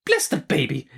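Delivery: mild exclamation; a small burst of feeling; exclaiming. Acted. Betsy about the coming baby, exclaiming. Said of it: "exclaimed Miss Betsy".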